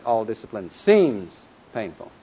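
"seems" stretched and punched, slow phrase; stress on SEEMS. Shown as a demonstration. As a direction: make "seems" stand out strongly. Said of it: "when we said".

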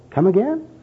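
high rising puzzled question; mock-bewildered query; acted bafflement. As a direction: pitch it up, totally lost. Bum baffled by church jargon.

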